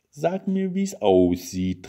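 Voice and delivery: high start, pressing and insistent; pushy pleading. A narrator's reading. Wife begs husband for a clue, pushy pleading. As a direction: nag for a clue, stretching the end. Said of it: "drängelte sie".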